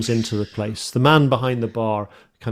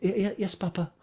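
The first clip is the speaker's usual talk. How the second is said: timid daughter; 9 semitones higher, 8 dB quieter, faster than usual. Acted. Timid daughter answers father.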